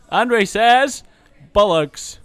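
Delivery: loud, theatrical reveal; dramatic announcement. Pretend. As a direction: announce it like a gameshow host. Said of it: "Dun dun".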